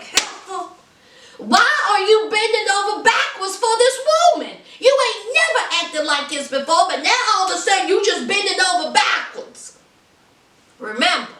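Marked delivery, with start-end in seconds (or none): 0.0-9.8 indignant Bill. Retold or imitated. Bill mocked as resentful.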